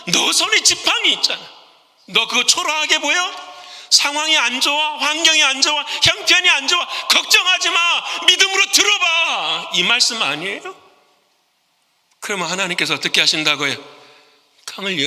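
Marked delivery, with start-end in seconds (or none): 0.1-9.7 high, rising, insistent urging; God urging. Retold or imitated. God urging Moses to raise the staff in faith, high and insistent. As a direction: push him to lift the staff in faith.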